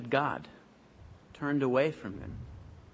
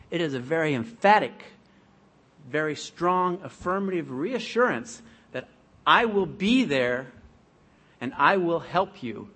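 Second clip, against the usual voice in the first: louder, slowing on "will be there"; emphatic; 4 semitones higher, 7 dB louder than usual. Real, not acted. Preacher assures the congregation, loud and emphatic. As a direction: press the promise home. Preacher stresses God's strong promise.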